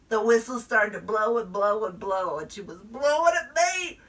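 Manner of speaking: ordinary delivery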